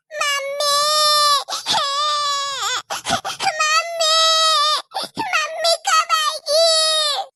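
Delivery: put-on high, tearful child wailing for mummy; a small girl sobbing and calling out; crying child calling mummy. Little daughter wailing for her absent mother, tearful.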